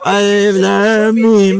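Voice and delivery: slow, lightly voiced recitation teacher; a hint of a patient home tutor showing how to read, slowed down; imitating the qari.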